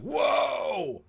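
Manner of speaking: sudden high-pitched whoa; surprised whoa. Retold or imitated. Paris gaping at the warship, amazed. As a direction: gasp it out in amazement. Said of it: "And Paris is like".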